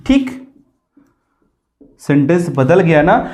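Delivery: ordinary talk, nothing marked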